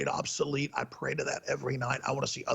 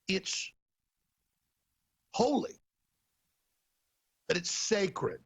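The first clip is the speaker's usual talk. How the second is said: halting, drawn-out mock reverence; mimicked pious pronouncement; 3 semitones higher, much slower than usual. Host quoting Tim Cook's award speech, mock-solemn. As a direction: pause before each solemn word, pitched up. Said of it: "He actually says".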